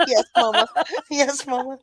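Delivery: mock dutiful child, laughing through it; laughing yes mama. Teased about texting Sandra, laughing mock obedience. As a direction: giggle back a playful yes mama. Teasing friend playing the scolded kid.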